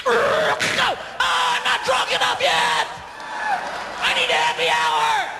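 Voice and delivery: mock drunk shouting; drunk guy. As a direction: slur it like a barfly. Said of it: "some guys walk home and they".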